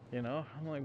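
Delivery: ordinary talk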